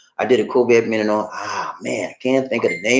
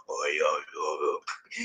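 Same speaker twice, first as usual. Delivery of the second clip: much lower, much softer raspy mobster murmur; Godfather rasp; 7 semitones lower, 12 dB quieter than usual. Retold or imitated. Host mocking ruined vocal cords, low Godfather rasp. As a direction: croak it like a gangster with wrecked vocal cords. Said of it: "talking like the Godfather".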